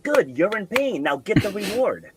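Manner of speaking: high-pitched, insistent push; coach urging. Retold or imitated. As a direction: drive a struggling climber on. Coach retold pushing a struggling climber.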